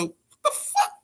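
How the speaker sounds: high, stunned what the fuck; squeaky disbelieving outburst; shocked. Retold or imitated. Hearing the fights got cancelled, high-pitched shock.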